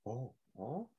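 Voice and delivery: very soft admiring oh oh oh; breathy ogling oh. Retold or imitated. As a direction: sigh it out, impressed. Betty reacting to flexing Momoa.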